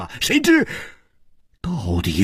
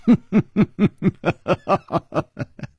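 high staccato villainess laugh; harsh laugh; 5 semitones higher than usual. A narrator's reading. Yinji answering an accusation with a harsh laugh. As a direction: laugh harshly in rapid bursts. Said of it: "尹姬厉声笑了".

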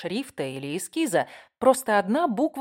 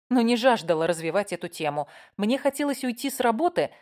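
plain reading voice

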